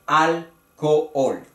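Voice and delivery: "alcohol" slowly in three syllables, al-co-ol; sílaba por sílaba. Shown as a demonstration. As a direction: separate the two o's in "alcohol". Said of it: "tres sílabas". ("alcohol" despacio, separado: al-co-ol)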